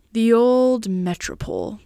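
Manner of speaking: slow, drawn-out gloomy reminiscence; gloomy brooding. A narrator's reading. Old friend recalling the Metropole, gloomy. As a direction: brood gloomily over a lost old haunt, slowly. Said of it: "brooded Mister Wolfsheim gloomily".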